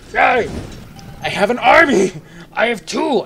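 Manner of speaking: loud, high-pitched celebration; gleeful victory shout; triumphant cheer. Real, not acted. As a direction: cheer your new army out loud. Celebrates gaining an army in game.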